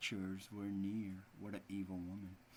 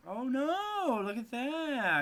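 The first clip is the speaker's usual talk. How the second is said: mock dismay; 11 semitones higher, 7 dB louder, slower than usual. Real, not acted. Teacher reacts to bad picture.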